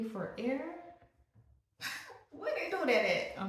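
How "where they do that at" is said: much higher, laughing disbelief; incredulous laugh. Real, not acted. Vlogger amazed at paying for air, laughing high.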